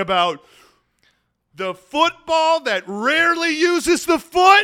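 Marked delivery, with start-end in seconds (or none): 1.5-4.6 emphatic reveal. Real, not acted.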